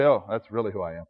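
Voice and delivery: low, soft admission to oneself; quiet self-realization. Acted. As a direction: say it small and low, as a humbling realization. Owning up to one's true self.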